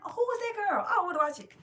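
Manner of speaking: high, loud excited squeal of curiosity; bursting with eagerness to watch. Retold or imitated. Vlogger retelling discovering the movie, excited high squeal.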